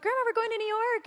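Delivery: retold excited call to grandma; excited announcement. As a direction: squeal the news to grandma. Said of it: "We were like".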